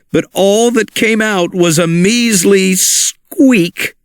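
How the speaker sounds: ordinary delivery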